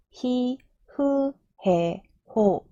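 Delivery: very slow, evenly spaced kana drill; chant-like slow row recital; syllable-by-syllable recitation. Shown as a demonstration. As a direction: model each syllable for learners, held and separated. Teacher models the ha row.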